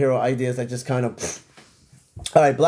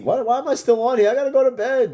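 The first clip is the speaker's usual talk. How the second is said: high, whiny exasperated complaint; 9 semitones higher, 3 dB louder than usual. Real, not acted. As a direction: whine it, pitched way up. Exasperated at still being live.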